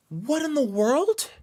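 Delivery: high, loud, drawn-out astonishment; stunned disbelief. Real, not acted. Streamer reacting to a baffling chat bug, stunned disbelief. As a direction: cry out slowly in total bafflement.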